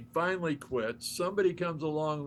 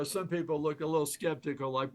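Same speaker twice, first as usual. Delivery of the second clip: usual speaking voice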